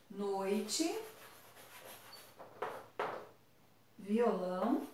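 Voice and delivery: quiet, careful reading of each word; slow reading aloud. Shown as a demonstration. Teacher reading a word list for a listening task. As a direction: read each word for the students to hear. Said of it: "leitura dessas palavras feita pelo professor".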